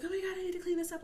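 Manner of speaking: high, flustered mock panic; retold panic. Mom racing to hide a toddler's spill, high retold panic.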